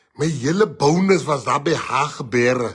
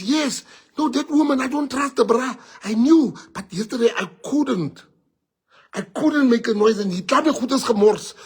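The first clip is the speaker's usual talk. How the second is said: higher friend explaining himself; higher-voiced friend; 7 semitones higher than usual. Friend explaining why he took his bonus, higher voice. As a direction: insist he knew all along. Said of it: "He says".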